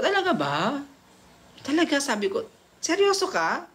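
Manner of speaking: bright mock astonishment; surprised disbelief. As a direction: gush with delighted disbelief. Surprised at his offer.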